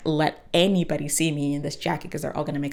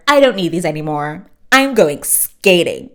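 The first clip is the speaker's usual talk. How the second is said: defiant declaration; 10 dB louder than usual. Retold or imitated. Prunella to the cashier after slamming crackers, loud triumphant declaration. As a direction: declare it loudly to the whole store.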